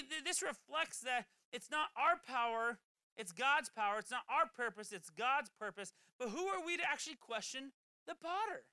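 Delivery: normal talking voice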